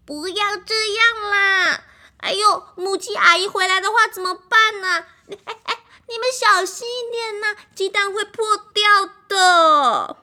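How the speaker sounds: high, loud anxious pleading; nervous pleading. Acted. Duckling begs friends to stop, high and anxious. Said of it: "鸭子好紧张哦".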